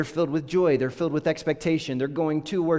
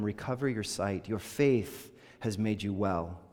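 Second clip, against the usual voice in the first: soft, slow healing words; 4 semitones lower, 6 dB quieter than usual. A narrator's reading. Jesus voiced healing the man.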